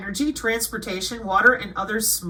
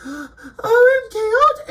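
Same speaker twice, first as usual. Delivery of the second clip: much higher crying wail; mock anguished cry; 12 semitones higher, 6 dB louder, much slower than usual. Mocking people traumatized by scary news, crying wail. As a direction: ham up a dramatic death cry.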